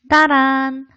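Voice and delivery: loud sung "ta-da" reveal; playful fanfare. Pretend. Teacher unveiling the tones to students, loud playful fanfare. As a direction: belt out one held fanfare note. Mock fanfare introducing a topic.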